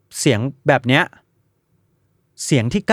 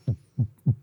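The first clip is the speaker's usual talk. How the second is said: short vocal thumps with no hiss; beatbox two clean kick hits; clean kick imitation; 4 semitones lower, 8 dB quieter than usual. Shown as a demonstration. Podcaster contrasting clean with noisy kicks, imitated thumps. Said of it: "กระเดื่องคีนแบบ".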